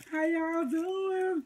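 high, drawn-out singsong hello; sing-song greeting. Real, not acted.

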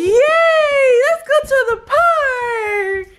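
high, loud happy cheering; excited cheer. Real, not acted. Mom cheers with her daughter about the park, loud excited cheer. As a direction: cheer like the park is the best news.

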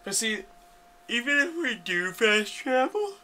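talking through a yawn; slow, stretched yawn-speak. Real, not acted. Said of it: "That's my yawning voice".

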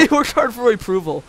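high, loud, through laughter; laughing incredulous. Real, not acted. Teasing co-player over the odd defence, laughing incredulous. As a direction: repeat it loudly, half laughing.

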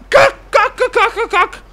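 very high, loud frantic swearing; shrill loud repeated curse; panicked cursing. Real, not acted. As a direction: spit it out in rising panic.

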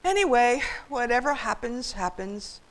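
high start sinking into resignation; whine, then give up; self-pitying shrug of a voice; whining. A narrator's reading. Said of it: "Sam whined".

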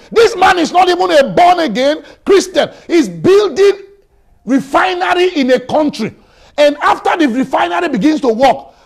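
loud, high-pitched preaching at full force; fiery preaching. Acted. Pastor preaching hard to the congregation, loud and high. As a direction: hammer it home to the congregation.

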